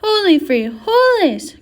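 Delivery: high, loud, drawn-out exclamation; cried out. A narrator's reading. Scapito thrusting his sword in the air, a high cry. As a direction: cry out slowly, swooping up on the second word. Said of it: "cried Scapito".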